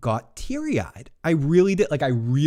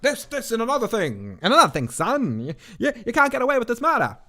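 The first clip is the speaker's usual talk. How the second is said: higher, hammed-up scolding voice, what he thinks he sounds like; mock tough-guy lecture; 10 semitones higher than usual. Imitating Steve Martin scolding the killer, higher and hammed-up. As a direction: play the clear-headed confronter, jabbing each phrase. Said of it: "he thinks he's talking normal".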